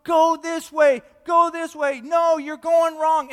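loud, high crowd yelling directions; urgent full-voiced yell. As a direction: shout out misleading directions over everyone. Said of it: "so people are yelling".

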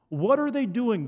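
normal talking voice

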